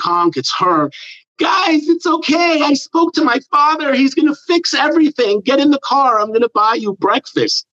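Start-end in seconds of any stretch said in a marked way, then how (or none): 1.4-7.6 put-on high, sweet, sing-song reassuring girl; bubbly spoiled-girl impression; chirpy girl voice. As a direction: call out cheerfully from the car, pitched way up. Girl voiced from car in story.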